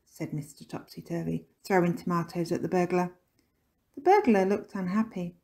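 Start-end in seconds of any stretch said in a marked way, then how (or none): none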